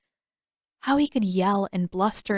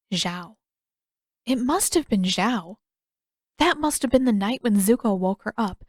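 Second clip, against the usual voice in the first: usual speaking voice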